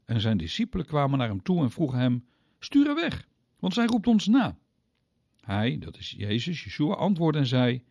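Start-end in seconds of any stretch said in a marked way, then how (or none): 2.6-4.6 much higher, exasperated push; impatient disciples. Acted. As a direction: brush her off, annoyed and urgent. Disciples urge Jesus dismiss her.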